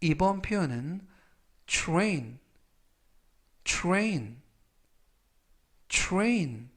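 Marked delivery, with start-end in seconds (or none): 1.6-6.7 train with tr like chr, said three times; train의 tr을 츄르처럼 발음; careful English demo. Shown as a demonstration. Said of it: "이라고 발음하시면 되겠고요". (츄레인처럼 또박또박 세 번)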